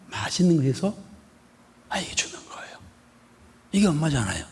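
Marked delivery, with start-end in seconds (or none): 1.9-2.8 soft whisper, tenderly. Real, not acted. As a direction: whisper it as a gentle secret. Tender point about mother. (속삭이듯 부드럽게)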